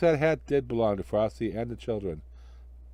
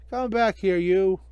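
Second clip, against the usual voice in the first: higher, louder yell after the rabbit; shouting; 8 semitones higher, 6 dB louder, slower than usual. Acted. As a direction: bellow at the runaway rabbit. Magician chases the rabbit.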